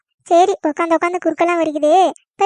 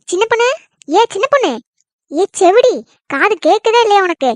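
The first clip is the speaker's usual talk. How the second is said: scolding wake-up call; 3 semitones higher, 3 dB louder than usual. Acted. Mother-in-law rousing sleeping daughter-in-law, scolding call. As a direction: call out crossly, rising on the name.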